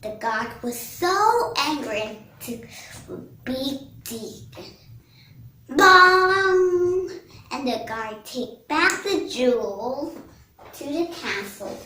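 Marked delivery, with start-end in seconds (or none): 5.7-7.1 loud, high, ringing vocal bong; imitated bong. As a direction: voice the blow as a drawn-out bong. Sound effect of guard's blow.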